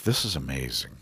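soft, private murmur of wonder; quietly amazed, just for herself; hushed aside to oneself. Retold or imitated. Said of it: "to herself".

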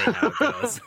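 loud laugh through words. Real, not acted. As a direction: say it while cracking up. Laughing while correcting.

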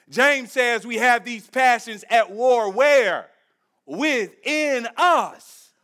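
normal talking voice

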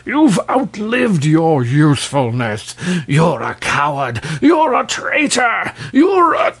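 furious, climbing to a squeal; shrieking rage. A narrator's reading. King berating the dragon in passion, shrieking. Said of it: "shrieked the king".

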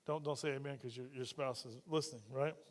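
dropped to a low, quiet aside; a quiet joking warning; hushed aside. Real, not acted. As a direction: lean in and say it under your breath.

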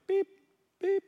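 short, high-pitched beeps; imitated scanner beep. Cashier keeps scanning groceries, high imitated beeps. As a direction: mimic a checkout scanner twice.

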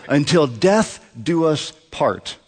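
slow, word by word; solemn vow. Retold or imitated. Pastor recalls his marriage vow beside his wife, slow and solemn. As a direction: recite a wedding vow with deliberate weight. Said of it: "I said".

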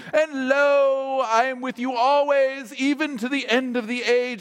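high, drawn-out King James intoning; grandly formal British scripture recitation; Shakespearean lilt. Acted. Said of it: "I always go to the King James".

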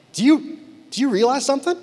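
high-pitched careful question; tentative nudge. Retold or imitated. Husband nudging wife about her accent, tentative high voice.

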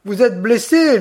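high, loud worried call, Orsanton drawn out slow; running up, out of breath and anxious; breathless alarm. A narrator's reading. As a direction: bandit rushing to his wounded friend. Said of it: "accourant tout essoufflé".

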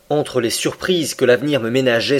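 ordinary delivery

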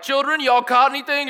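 high, loud holler from shore; stranger calling out. Retold or imitated. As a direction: call it out to distant fishermen. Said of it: "The stranger's like".

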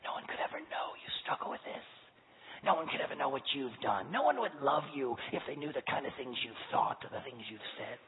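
hushed shaming whisper; soft hissing shame; serpent's whisper. A narrator's reading. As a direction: whisper it like a tempter in his ear. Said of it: "whisper about shame".

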